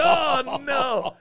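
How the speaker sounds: comic dismay. Retold or imitated. Dismay at outfit news.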